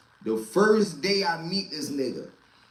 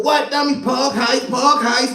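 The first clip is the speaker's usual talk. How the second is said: high, hyped chanting; cocky outburst; 6 semitones higher, 3 dB louder than usual. Retold or imitated. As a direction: brush it off with a proud chant. Pookie defending the theft.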